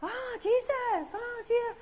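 high, slow awed cry; voiced Italian wife. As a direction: imitate her drawn-out wonder. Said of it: "and she went".

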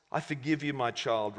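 soft, low voice of God; gentle. A narrator's reading. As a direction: forgive tenderly, letting the voice sink. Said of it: "replied God gently".

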